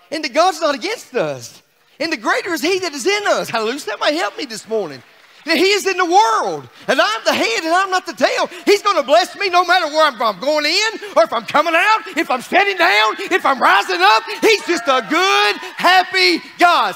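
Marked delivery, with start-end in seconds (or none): 5.5-17.0 loud preaching climb; preaching at full force. Acted. Pastor telling the church God is good, preaching loud. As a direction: drive each line home to the church.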